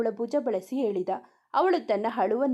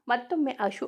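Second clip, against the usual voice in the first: ordinary delivery, nothing marked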